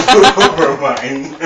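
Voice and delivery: slow Over mine through laughter; giggling retry; laughing. Real, not acted. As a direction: drag it out laughing. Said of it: "Over me?".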